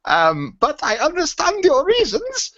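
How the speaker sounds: put-on very high, slow comic German accent. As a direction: read it out in a hammed-up German accent. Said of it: "probably shouldn't do it in that accent".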